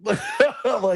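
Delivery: high burst of laughter on the word; laughing. Real, not acted. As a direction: laugh it out, amused. Laughing at the story.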